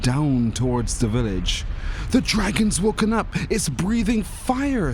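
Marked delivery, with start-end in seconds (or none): 2.1-4.9 put-on excited child. A narrator's reading. Boy sees lava, thinks dragon.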